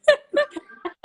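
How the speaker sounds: loud high laugh bursting out; burst of laughter. Real, not acted. Laughing about husband.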